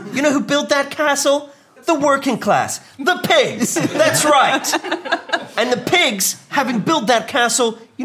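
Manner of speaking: rousing stump speech. Acted. Rallying the crowd for the pigs.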